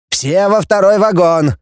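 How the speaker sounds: high-pitched order to the group; loud command. A narrator's reading. Voronin orders the group into the carriage, commanding. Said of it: "громко скомандовал Воронин".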